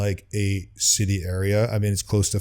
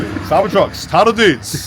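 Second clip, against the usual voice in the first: high, loud mock Australian accent; hammed-up Australian accent; 10 semitones higher, 6 dB louder, slower than usual. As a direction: put on a big Australian voice. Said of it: "It's a, it's a Australian".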